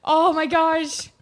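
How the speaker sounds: high longing gush. Real, not acted. Longing for a baby.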